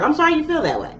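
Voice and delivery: high, sugary mock-sweet apology; sarcastic fake apology. Cancer brushing off a slighted person, mock sweet. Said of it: "you're like".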